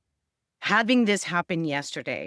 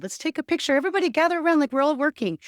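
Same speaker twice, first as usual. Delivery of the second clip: much higher, brighter mom voice, fast; mom's cheerful call; 11 semitones higher, 5 dB louder, faster than usual. Retold or imitated. Mom gathering the family for a staged photo, high and bubbly. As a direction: mimic mom rallying everyone for a photo. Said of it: "my mom said".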